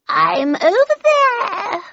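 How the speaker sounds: very high, slow feline singsong; purring cat. A narrator's reading. Cheshire-like cat answers the bellman's roll call, purring. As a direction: stretch it out into a loud purr. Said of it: "purred the cat".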